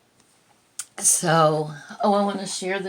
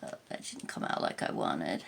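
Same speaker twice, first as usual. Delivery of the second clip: fighting back real tears; a catch in the throat, almost breathless; choked up; 13 semitones lower, 7 dB quieter than usual. Real, not acted. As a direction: voice dropping away, barely voiced. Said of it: "I'm sorry".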